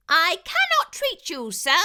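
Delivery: high, loud squeaky mouse shout; a tiny mouse calling out loudly, high; mouse dentist shouting. A narrator's reading. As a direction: shout up at the fox from the window. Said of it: "Doctor DeSoto shouted".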